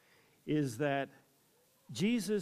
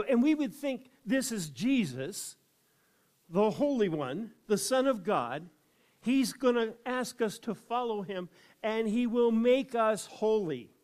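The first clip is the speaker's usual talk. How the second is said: higher and louder throughout; preaching at full force; 6 semitones higher, 5 dB louder than usual. Real, not acted. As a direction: drive the point to the crowd.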